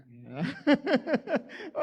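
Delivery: loud rising laugh; cracking up at the deaf joke; burst of laughter. Real, not acted. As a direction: laugh out at your own joke. Laughs at own deafness joke.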